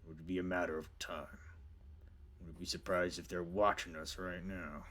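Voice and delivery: low, soft creature rumble; deep, subdued bear boss. Acted. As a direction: speak low and quietly as a brooding bear. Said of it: "the bear go".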